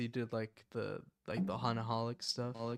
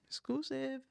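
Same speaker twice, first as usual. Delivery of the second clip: way higher, squeaky hype voice; hyped squeal; 14 semitones higher, 4 dB quieter than usual. Acted. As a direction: squeal the word Exclusive like a hype man. Hyping the song to viewers.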